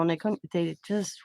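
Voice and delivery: ordinary talk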